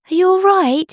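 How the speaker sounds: high, loud concerned call. Acted. Bunny calling toward a crying sound, high and loud. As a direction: call out to find who's crying.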